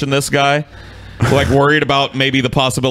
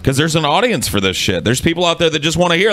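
normal talking voice

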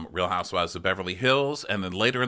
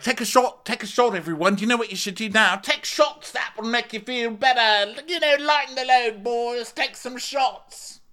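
much higher, louder goofy party voice; mock Schwartz; 13 semitones higher, 4 dB louder than usual. Imitating Schwartz urging the boys to drink, in a high goofy shout. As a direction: shout it like a giddy frat boy pushing drinks.

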